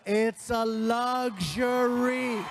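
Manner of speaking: very slow shouted luxury; dad's final booming verdict; shouted punchline. Retold or imitated. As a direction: bellow the last word home. Dad lecturing son.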